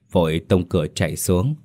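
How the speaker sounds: plain reading voice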